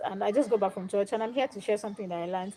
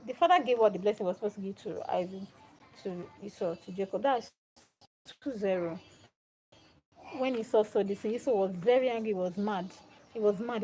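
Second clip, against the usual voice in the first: plain reading voice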